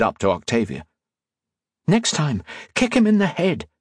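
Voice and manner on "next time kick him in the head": lightly voiced high female character; raised pitch, no yell; chiding heroine. A narrator's reading. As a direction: say it high, as a pointed reminder. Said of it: "she said".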